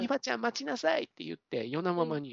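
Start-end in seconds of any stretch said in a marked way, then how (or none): 0.0-1.0 high-pitched dramatic call to stop; acted urgent command without shouting; retold calling after. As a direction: call after someone as in a melodrama. Heroine calls after someone running off.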